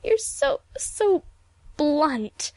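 very high, broken tearful complaint; sobbing. A narrator's reading. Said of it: "she sobbed".

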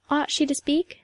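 plain reading voice

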